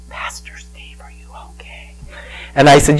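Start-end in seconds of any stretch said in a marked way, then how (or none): 0.1-1.9 unvoiced, very soft question; concerned whisper. Pastor's friend's mom checking on him in church, hushed whisper. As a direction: whisper it gently to someone crying.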